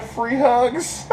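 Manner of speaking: mock sign voice. Reading the sign aloud.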